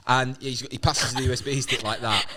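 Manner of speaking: ordinary delivery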